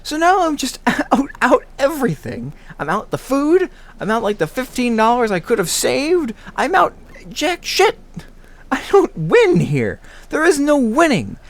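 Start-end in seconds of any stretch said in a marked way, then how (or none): none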